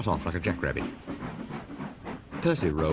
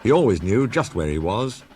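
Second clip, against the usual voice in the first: ordinary delivery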